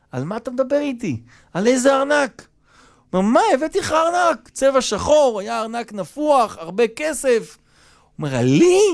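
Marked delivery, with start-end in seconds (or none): 0.1-2.5 high-pitched, puzzled questioning; baffled denial. Retold or imitated. Host tells the guest he knows nothing of any wallet, baffled. 3.3-7.6 high-pitched, agitated retort; indignant insistence. Retold or imitated. Guest insists to host about his wallet, indignant. 8.4-8.8 high, sharply rising question; incredulous. Retold or imitated. Host answers the guest's claim, incredulous.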